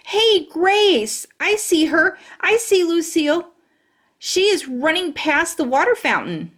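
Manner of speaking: high, eager call across the playground; calling out excited. Acted. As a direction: shout out that you spotted her. Spotting Lucille, calling out.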